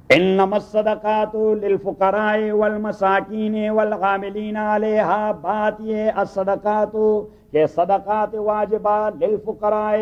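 slow chanted Quranic recitation. Acted. Teacher reciting the verse to his students, slow melodic chant. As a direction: intone the Arabic verse slowly and evenly.

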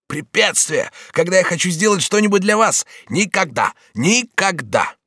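high, impassioned protest; scoff, then stretch the last word out; indignant devotion. Acted. Bonifas swears no obstacle will stop him, indignant devotion.

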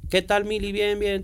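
high-pitched mock small talk; re-enacted greeting. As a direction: act out a greeting, pitched well up. Acting out a bookshop greeting.

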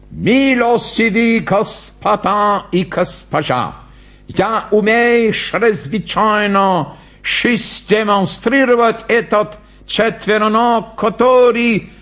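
high, slow, stilted showman's address; pompous clown announcer. Acted. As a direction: announce laboriously, in mangled grand phrases. (напыщенно и медленно, голосом клоуна-конферансье)

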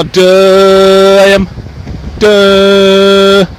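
loud, high, sustained "duh" like a horn; mocking honk imitation. Man mocking honking drivers, loud honk imitation. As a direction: hold a dopey honk on one note. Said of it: "Every time someone honks".

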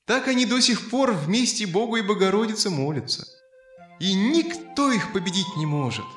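plain reading voice

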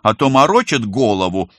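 loud, indignant outburst. Acted. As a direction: snap in exasperation. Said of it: "возмущение".

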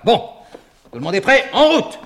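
loud, high rallying cry; rallying shout. Acted. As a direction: rouse the troop to march. Rallying the band.

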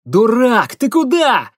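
sharp alarmed yell, high and loud; shouting. Acted. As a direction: yell it across to a friend. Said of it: "крикнул".

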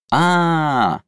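high, loud dawning aah; drawn-out realizing aah. A narrator's reading. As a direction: let the realization land loudly. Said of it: "сообразила Голем".

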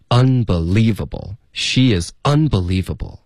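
slow "unbelievable", UN and LIE stressed; two stresses. Shown as a demonstration. Teacher modelling the full line for learners, two stresses. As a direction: stretch "she is unbelievable", leaning on un and lie. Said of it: "就是这么说的".

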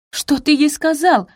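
high, loud, slow angry demand; angry outburst. Acted. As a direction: lash out at him. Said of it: "набросилась на Бенедикта Софи".

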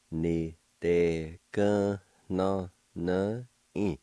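"Ni de gun no no in" slow and segmented; syllable by syllable. Shown as a demonstration. Teacher pronouncing word number four for learners, slowly in syllables. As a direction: draw out each syllable separately. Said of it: "now I'll pronounce these words".

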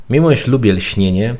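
ordinary talk

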